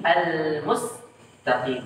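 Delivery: "al-mustaqim" broken by a halt after the s; "al-mustaqim" split as "al-mus, taqim"; pause on sin. Shown as a demonstration. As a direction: hold "mus", stop briefly, then finish "al-mustaqim". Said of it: "Berhenti sejenak atau saktah pada sin".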